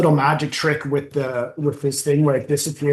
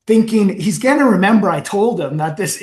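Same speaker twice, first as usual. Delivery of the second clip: ordinary talk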